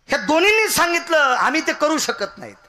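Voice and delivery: high, quick, forceful outburst; loud proclamation. Acted. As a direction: proclaim it loudly to the whole gathering. Preacher proclaims the gods' refusal. (उंच आवाजात जोरदार घोषणा)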